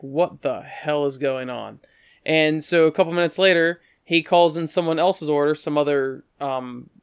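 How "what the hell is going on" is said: slow, drawn-out bafflement; understated, slow disbelief; baffled. Retold or imitated. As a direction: imitate a stunned aside to friends. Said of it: "look at each other like".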